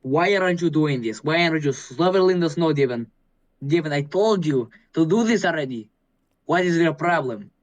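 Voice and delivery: put-on nagging mother with a Russian accent. Mother scolding over undone chores, Russian accent. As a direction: scold like an exasperated Russian mom. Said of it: "my mother would say".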